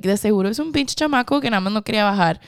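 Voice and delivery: rapid irritated Spanish grumble; fast annoyed rant. Retold or imitated. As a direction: rattle it off, fed up. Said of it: "I was just like".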